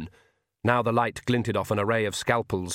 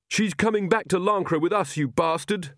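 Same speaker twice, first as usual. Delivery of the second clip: screaming; 7 semitones higher than usual. A narrator's reading. Magrat defies the Count over her baby, in a restrained scream. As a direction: raise the pitch in a contained outburst. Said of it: "screamed Magrat".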